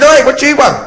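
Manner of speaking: high, loud retort; irritated shouting. Acted. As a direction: shout back at a deaf old man. Acted shouted exchange on plane.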